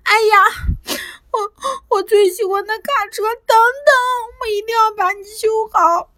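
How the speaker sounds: high, tearful, broken voice; boy upset over his smashed toy truck. A narrator's reading. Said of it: "他难怪地说".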